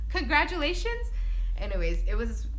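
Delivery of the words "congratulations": high, loud, bright-voiced imitation; cheery congratulations. Friend at the desk answering her news, loud and high. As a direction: play the friend's cheerful reply.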